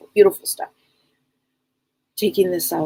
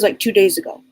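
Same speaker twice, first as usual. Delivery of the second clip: usual speaking voice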